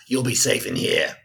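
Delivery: lightly voiced Cockney zombie; Billy's Cockney voice. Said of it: "That's the same voice".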